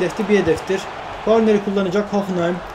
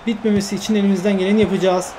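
ordinary delivery

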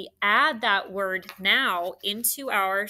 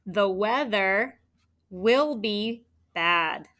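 slow, every word spaced out; teacher dictating the sentence; slow dictation; 3 dB louder, slower than usual. Shown as a demonstration. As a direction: dictate the whole sentence slowly. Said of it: "Which one?".

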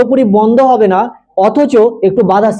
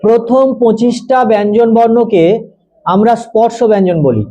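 slow dictation; slower than usual. Acted. Teacher dictating.

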